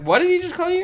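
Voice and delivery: high, loud incredulous question; acted disbelief. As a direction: replay a startled dad's question. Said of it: "I was like".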